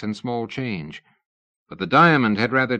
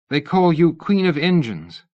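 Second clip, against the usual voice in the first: a lightly higher, eager Missus Tournachon; eager fan; 8 semitones higher, 6 dB louder than usual. A narrator's reading. Missus Tournachon asking Lady Ada to sign, eager. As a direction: gush a little at the famous lady.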